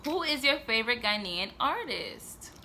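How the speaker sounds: ordinary delivery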